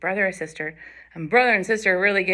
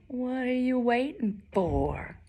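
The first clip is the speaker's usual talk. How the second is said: slow, softer challenge; daring big goat; 4 semitones higher, 5 dB quieter, slower than usual. Acted. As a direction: draw the dare out slowly. Big goat dares the troll.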